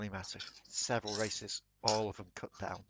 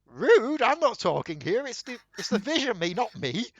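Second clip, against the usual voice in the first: squeaky, offended high-pitched whine; indignant high voice; 11 semitones higher, 11 dB louder than usual. Acted. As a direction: play the affronted prisoner, high and loud. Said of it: "is like".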